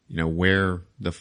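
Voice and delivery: ordinary talk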